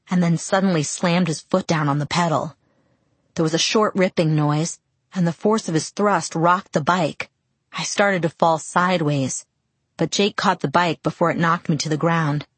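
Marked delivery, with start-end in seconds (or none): none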